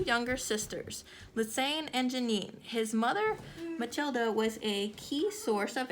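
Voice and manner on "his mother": ordinary delivery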